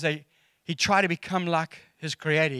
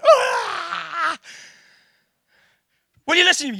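high, strained shout of frustration; dad yelling at a daughter who ignores him; exasperated shout; 11 semitones higher, 4 dB louder, slower than usual. Retold or imitated. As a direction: yell it as a fed-up father.

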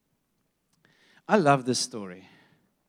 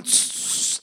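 unvoiced shush; imitated crowd's "shh"; 9 dB quieter than usual. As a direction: hush someone like a disapproving crowd. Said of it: "And everyone".

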